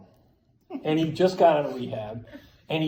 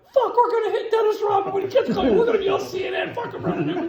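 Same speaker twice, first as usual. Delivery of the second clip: high-pitched, loud panicked yell, retold; frantic squealed alarm; panicked outburst; 5 dB louder, faster than usual. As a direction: blurt it out in mock panic, pitch way up. Acts out his panic while driving.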